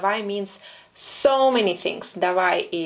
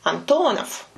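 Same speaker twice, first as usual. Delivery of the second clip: Антонов with the stress on ТО; correct middle stress; 5 semitones higher than usual. Shown as a demonstration. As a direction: say Антонов as an-TO-nov, middle syllable lifted. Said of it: "here he said it all correctly".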